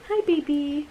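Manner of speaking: soft, gentle hello to a sick pet; tender to dog. Real, not acted. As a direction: coo softly to the dog lying down. Greeting her sick dog.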